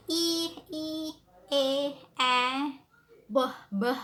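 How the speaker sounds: each sound held out clearly, "e, e", then a punchy "b, b"; isolated practice sounds. Shown as a demonstration. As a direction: drill "e, e, e, e, b, b" one by one, loudly. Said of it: "start to practice by saying the sounds".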